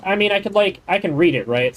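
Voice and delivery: ordinary talk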